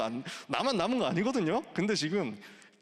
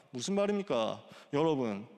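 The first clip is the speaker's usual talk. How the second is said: usual speaking voice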